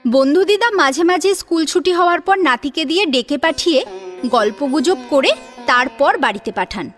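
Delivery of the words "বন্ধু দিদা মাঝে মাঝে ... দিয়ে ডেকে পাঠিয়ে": normal talking voice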